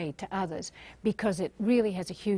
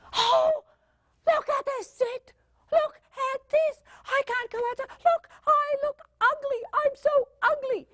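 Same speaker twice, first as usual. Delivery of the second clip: put-on high-pitched, wailing teen meltdown; hammed-up teenage despair, pitched way up; whining teenager. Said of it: "a teenager will come up and".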